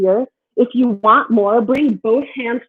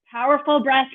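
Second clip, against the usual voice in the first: normal talking voice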